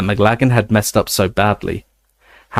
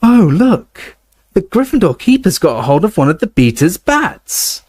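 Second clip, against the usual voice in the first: excited; 9 semitones higher than usual. A narrator's reading. Luna commentating excitedly.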